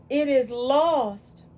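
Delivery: slow and loud; dramatic. Acted. Teacher dramatizing the lost stick, slow and loud. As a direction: stretch each word out for drama.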